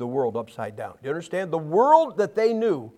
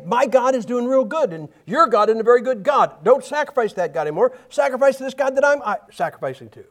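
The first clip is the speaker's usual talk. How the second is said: boasting Roman quote; 6 semitones higher, 5 dB louder than usual. Retold or imitated. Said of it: "they would say".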